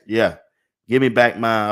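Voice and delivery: ordinary talk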